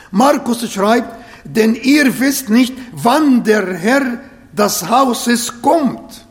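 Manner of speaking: ordinary talk, nothing marked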